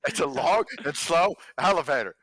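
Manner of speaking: loud, high playful jab; teasing. Pretend. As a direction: tease them about it. GM teasing reply.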